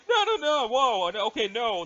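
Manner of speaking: flustered, laughing no's; laughing protest. Acted. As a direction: laugh it off, embarrassed. Flustered by a teasing question.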